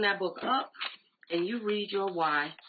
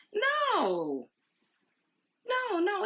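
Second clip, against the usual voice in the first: emphatic no; 14 semitones higher, much slower than usual. Real, not acted. Rejecting the shy framing.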